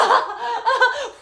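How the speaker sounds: high imitated cackle; mock fit of high laughter; hysterical laughter. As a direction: imitate the driver cracking up. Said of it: "starts laughing hysterically".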